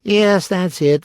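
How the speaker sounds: slow, drawn-out sighing admission; high and loud, falling like a sigh. A narrator's reading. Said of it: "said the Hatter with a sigh".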